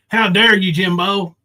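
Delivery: normal talking voice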